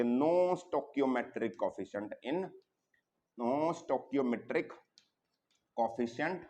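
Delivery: very slow, spaced-out note dictation. Shown as a demonstration. Teacher dictating a rule as it is written, slow dictation. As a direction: dictate it piece by piece, pausing.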